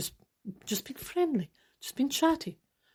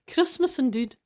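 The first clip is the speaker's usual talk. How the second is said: usual speaking voice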